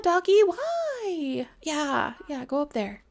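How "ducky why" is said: drawn-out high lament; lamenting a duck gone astray; despairing wail. Real, not acted. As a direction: wail at the duck's betrayal.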